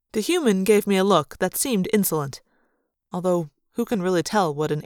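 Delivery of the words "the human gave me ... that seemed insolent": plain reading voice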